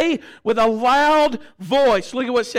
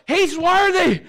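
raised, high-pitched cry of praise; imitated excited shouting; 9 semitones higher, 4 dB louder, slower than usual. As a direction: call it out like the overexcited convert. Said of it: "Man was going".

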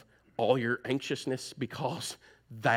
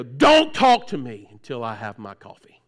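loud grumpy snap, mock-irritated; grumpy before coffee; 10 dB louder than usual. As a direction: bark it like a grouch before his coffee. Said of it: "And they go".

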